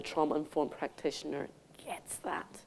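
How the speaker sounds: soft confiding whisper. Real, not acted. Speaker confides to the audience in a hushed whisper. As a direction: let it drop to a whisper, like a secret.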